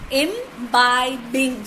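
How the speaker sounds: "imbibing" slowly split into im-bi-bing; three separate drawn-out beats on "imbibing"; syllable by syllable. Shown as a demonstration. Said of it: "So three".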